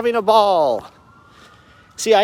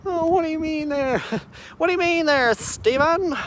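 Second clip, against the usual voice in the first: much higher, lightly put-on squeak; squeaky mock voice; 12 semitones higher than usual. Someone questioning Steven, high mocking squeak. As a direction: tease himself in a high little voice.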